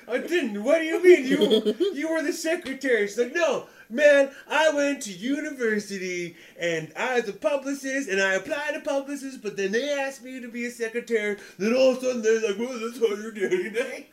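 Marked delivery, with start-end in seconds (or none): 0.1-3.1 high, laughing disbelief; laughing protest. Retold or imitated. Retells his confused reply. 3.3-14.1 higher, louder, laughing imitation of her complaint; mock heckler rant. Retold heckler's backstory rant.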